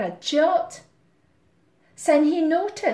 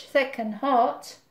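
ordinary delivery, nothing marked

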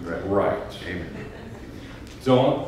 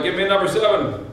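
higher-pitched cashier's call; raised pitch, mock counter call; calling out an order; 7 semitones higher than usual. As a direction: call an order back to the kitchen, lightly acted. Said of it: "Cashier calls out".

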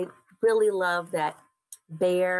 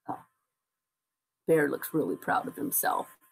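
ordinary delivery, nothing marked

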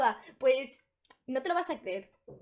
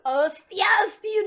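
loud burst of surprise at the joke; surprised shout; 9 semitones higher, 12 dB louder, much slower than usual. Real, not acted. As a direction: shout it out, genuinely amazed. Reacting to a joke. (grito de sorpresa real)

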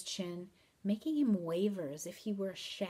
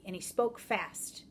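ordinary delivery

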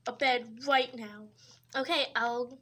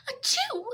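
sneeze; 11 semitones higher than usual. Acted. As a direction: let out a big sneeze. Sick person sneezes.

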